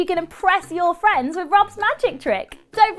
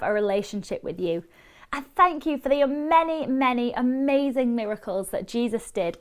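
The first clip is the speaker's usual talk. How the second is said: prayer; 7 semitones lower, 6 dB quieter than usual. Acted. Leads a prayer.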